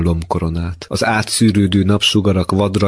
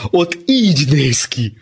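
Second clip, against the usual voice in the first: high, slow showman's tease; mocking; 8 semitones higher than usual. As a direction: present the imitation teasingly. Ábel introduces his mocking imitation. (gúnyosan, magas, lassú hangon)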